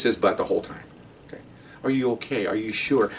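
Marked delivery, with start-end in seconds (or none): 1.8-3.1 soft, put-on concern; imitated tender check-in; mock solicitous supervisor. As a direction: fuss softly over the suspect. Mocking the fussing supervisor.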